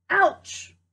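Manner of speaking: sudden high, loud yelp; cry of pain. Acted. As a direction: yelp as the acorn hits her. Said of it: "she cried".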